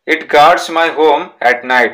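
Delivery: each word slow and separate for the class; slow reading aloud. Shown as a demonstration. Teacher dictating a textbook sentence to pupils, slow and deliberate. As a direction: read it out slowly so pupils can write it down.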